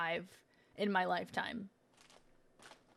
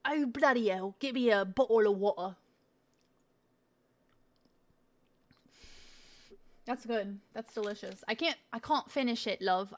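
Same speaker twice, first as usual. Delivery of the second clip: mild mock British accent; a light put-on British accent. Doing a British accent while tasting snacks. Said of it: "British accent".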